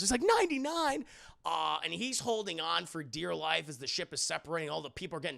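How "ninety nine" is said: high, shocked cry on ninety-nine; acted high-pitched dismay; alarmed disbelief. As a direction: echo ninety-nine back in panic. Peter appalled at the figure.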